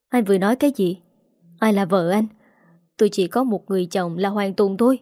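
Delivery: ordinary delivery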